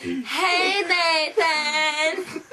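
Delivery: loud, high, drawn-out greeting; drawn-out call. Retold or imitated. Girl imitating how a guy always greets people, drawn-out call. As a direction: holler a hey in imitation. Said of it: "where he did not go, Hey".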